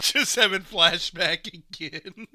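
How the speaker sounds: loud, slow words through real laughter; laughing. Real, not acted. Laughing over his own joke.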